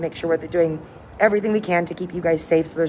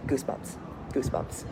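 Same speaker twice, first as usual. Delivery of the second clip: breathy awed whisper; 5 semitones lower, 12 dB quieter than usual. Real, not acted. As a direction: whisper it in awe. Awed reaction to cruise history.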